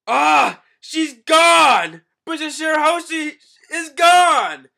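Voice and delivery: high, loud alarmed yell; panicked shout. Pretend. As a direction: scream the alarm that she's gone. Guard finds princess missing.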